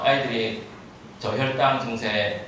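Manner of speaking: ordinary talk, nothing marked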